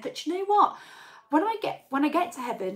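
playing her mum with a knowing lilt; mum's voice. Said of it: "And she said".

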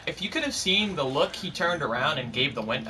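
ordinary talk

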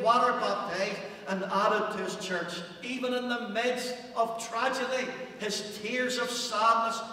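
a revival sermon climbing toward its height; preaching at full force. Real, not acted. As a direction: preach it with rising fire.

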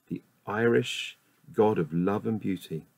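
ordinary talk, nothing marked

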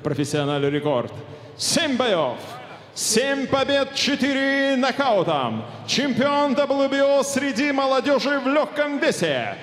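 rising arena announcement; boxing ring announcer. Real, not acted. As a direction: build hype toward the name. Ring announcer builds up fighter.

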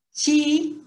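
Chi with a CH sound, held long and high; pronunciation demo. Shown as a demonstration. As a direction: model Chi loudly, as if written CH. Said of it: "se pronuncia como si fuera C H". ("Chi" con sonido de CH, sílaba alargada)

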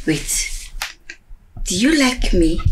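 high-pitched, sighing tipsy talk. Acted. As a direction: let the drink lift your voice. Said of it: "I'm just so tipsy".

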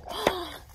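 a hushed surprised gasp; mock gasp. Shown as a demonstration. Storyteller pretending a sudden discovery, gasp. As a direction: gasp as if you just realised. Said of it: "storyteller realises connection".

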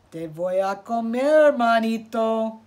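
loud menacing Spanish threat. Acted. Coyote threatens rabbit.

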